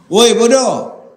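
loud high yell of woi bodoh; shouted insult. Acted. Ustaz shows shouting back at a man who insulted him, loud.

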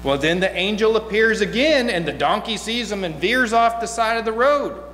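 ordinary talk

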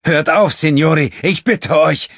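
high, loud cry begging them to stop; desperate pleading shout. Acted. Wounded fisherman stops the fight.

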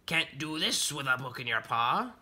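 teasing pirate character voice; Bartholomew Bunny. Acted. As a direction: goad the reader like an old sea hand. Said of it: "Bartholomew Bunny said while sanding his peg leg".

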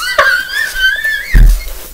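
real squealing laughter; high-pitched laughing fit. Real, not acted. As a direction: crack up helplessly, very high. Cracks up at own quote.

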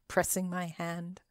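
plain reading voice

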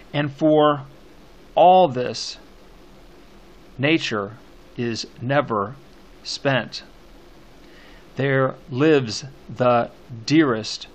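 slow, loud verse with long pauses; poem scansion reading. Shown as a demonstration. Teacher scanning the poem for students, slow emphatic recitation.

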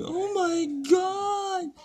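shrill panicked shriek, then drawn-out cry; screaming in fright. Real, not acted. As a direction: shriek high in real panic. Freaking out at moving lizard.

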